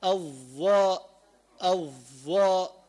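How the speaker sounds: الظاء drawn out slowly, tongue tip between teeth; الظاء ببطء مع إخراج اللسان; sounding out the letter. Shown as a demonstration. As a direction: stretch out الظاء to show the letter. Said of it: "أما الظاء أين مخرجها".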